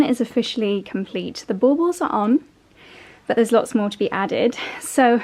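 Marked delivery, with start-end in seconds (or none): none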